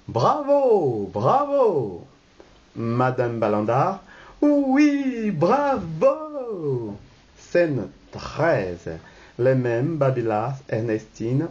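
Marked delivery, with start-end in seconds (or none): 0.1-2.1 high, drawn-out bravos; acclaiming. Acted. All the guests applaud the announcement, acclaiming. 4.4-7.0 high, long swooping cheer; gushing approval. Acted. Madame Balandard seconds the guests' cheer, gushing approval.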